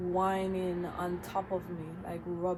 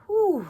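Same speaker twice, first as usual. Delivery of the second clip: sharp panicked exhale; 13 semitones higher, 13 dB louder than usual. Real, not acted. Calming down from panic.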